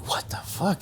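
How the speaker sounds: whispered puzzlement. Retold or imitated. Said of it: "And I'm like".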